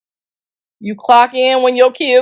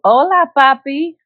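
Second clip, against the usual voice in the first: drawn-out greeting in a put-on Mexican accent; slow, flirty Mexican-accented hello; 2 semitones higher than usual. Shown as a demonstration. Phone worker answering a caller, mock Mexican voice. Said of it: "and your Mexican voice".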